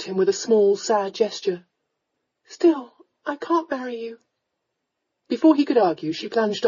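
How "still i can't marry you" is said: put-on high, pained young woman; sad refusal. Young lady stops lord's proposal, sadly. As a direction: refuse him in a high, sorrowful voice.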